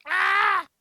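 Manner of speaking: loud, high yell; short high cry of frustration; exasperated yell. Real, not acted. Player stumped by the voice request, exasperated yell.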